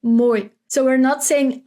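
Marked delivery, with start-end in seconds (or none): none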